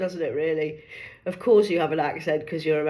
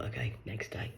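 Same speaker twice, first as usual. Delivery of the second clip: ordinary talk, nothing marked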